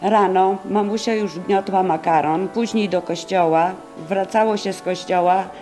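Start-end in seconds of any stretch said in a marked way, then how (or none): none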